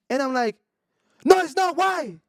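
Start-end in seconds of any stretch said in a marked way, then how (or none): 1.2-2.2 high, loud retort in imitation; mock screaming back. Man answering the screaming woman, mock yelled back. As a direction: fire it back at her pitch, louder. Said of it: "I'm screaming back at you".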